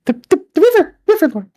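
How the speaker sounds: put-on high-pitched, loud little girl figure; high, loud river-girl character voice. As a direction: pipe up in a very high voice. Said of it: "one of the girls".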